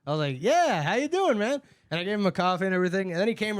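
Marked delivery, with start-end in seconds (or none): 0.4-1.6 high, loud eager welcome; turned-on charm; pitched way up, overly friendly; eager greeting. Retold or imitated. His own eager greeting retold.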